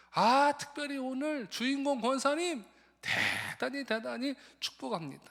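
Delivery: high, loud, grand blessing in halting Korean; a missionary confusing the 60th-birthday word, proud and loud; dazed foreign missionary. Retold or imitated. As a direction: bless everyone joyfully while mangling the words. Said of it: "선교사님이 이러는 거예요".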